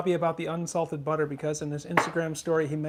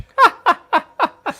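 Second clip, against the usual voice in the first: burst of laughter; 15 dB louder than usual. Real, not acted. Co-host reads teasing comment.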